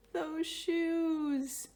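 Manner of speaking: high, loud cry of delight; it's them at last; a high, joyful cry of discovery; thrilled. A narrator's reading. Finding the wanted shoes.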